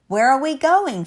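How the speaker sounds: high, loud, alarmed question. Acted. Finn to the balloon man, most alarmed. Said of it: "most alarmed".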